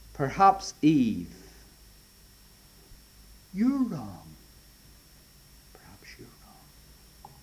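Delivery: slow, hushed serpent sliding into a whisper; tempting serpent. Acted. Serpent whispering doubt to Eve, slow and hushed. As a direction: coax her slowly, barely voiced.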